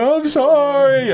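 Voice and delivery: high, drawn-out fake blubbering; hammed-up tearful plea; put-on crying apology. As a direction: wail it like a badly faked cry. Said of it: "I'm putting on".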